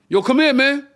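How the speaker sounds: insistent call. Retold or imitated. Drunk guy yelling at him across the counter, insistent call. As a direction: yell him over, getting impatient.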